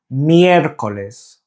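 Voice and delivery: slow "Miércoles" with a long "Miér"; stress on first syllable. Shown as a demonstration. Teacher giving a model to repeat, "Miércoles" slowly stressed on "Miér". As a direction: lean on "Miér" and stretch it out. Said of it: "it's the first syllable that is the stressed syllable".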